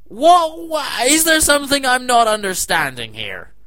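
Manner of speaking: high, loud shocked what; outraged at the replaced maid, high and loud; outraged disbelief. Real, not acted. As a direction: explode in disbelief.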